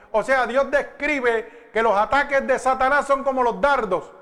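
loud, high, urgent sermon delivery; full-force preaching. Acted. Pastor warns the congregation about Satan's darts, loud preaching. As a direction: drive it home to the congregation.

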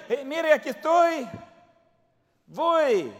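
loud, high, bursting to go; eager offer. Pretend. You as Ahimás, eagerly volunteering in battle. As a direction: throw your hand up, eager to run.

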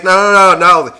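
insistent refusal. Retold or imitated. Retold boyfriend refusing charges.

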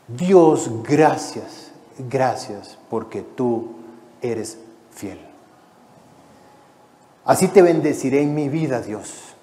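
soft, slow thanksgiving prayer to God; a hushed, lightly voiced prayer of thanks; quiet prayer. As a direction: pray it gently, trailing off. Prays aloud in sermon.

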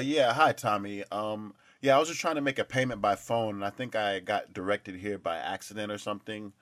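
normal talking voice

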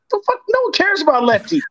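high incredulous outburst breaking into a laugh; retold exasperation. Retelling his answer to his mom, high laughing disbelief. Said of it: "I'm like".

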